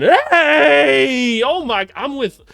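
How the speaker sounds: high, loud yelp; excited shout. Real, not acted. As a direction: jump up at the play. Excited by a made play.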